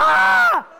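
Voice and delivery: loud, very high scream; piercing high shriek. Shown as a demonstration. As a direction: let out a full scream. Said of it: "scream it out".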